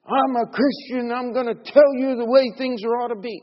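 high, loud, preachy mock lecture. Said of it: "some guys that do this artificially".

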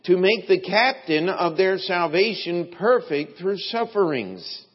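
loud, emphatic pulpit reading; scripture reading. Acted. Preacher reads scripture to the congregation, loud and emphatic. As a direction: read the verse out with building force.

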